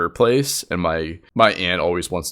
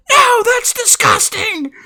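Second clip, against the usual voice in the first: very high, drawn-out protest; hammed-up shriek of disgust; mock disgusted yell; 6 dB louder, slower than usual. Relative objects to rinsing the turkey, shrieked mock disgust.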